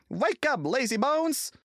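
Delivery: high, loud cheeky taunt; small tank engine jeering in a high loud voice; cheeky little engine. A narrator's reading. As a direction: tease a sleepy big engine, high and loud. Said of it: "came up in his cheeky way".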